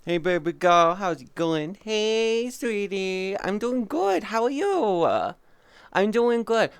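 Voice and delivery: high, sing-song hello; cheerful high-pitched greeting; sweet greeting. Real, not acted. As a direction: coo a friendly hello to the chat.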